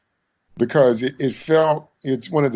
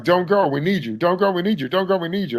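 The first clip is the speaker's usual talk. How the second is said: higher, hammed-up repeated plea; mock pleading; 5 semitones higher, faster than usual. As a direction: overplay a begging farewell. Mocking a cheesy pleading scene.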